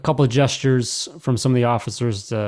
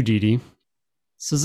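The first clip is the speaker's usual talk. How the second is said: ordinary delivery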